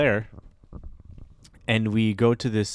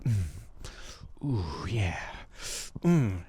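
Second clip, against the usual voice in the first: low, soft, sultry hums while grooving; a lightly voiced groove-out moan from the dancing man; 6 semitones lower, 8 dB quieter, slower than usual. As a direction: hum it like you're lost in the music.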